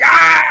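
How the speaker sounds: loud, high mock scream; short burst of comic alarm. Mock reaction to getting help.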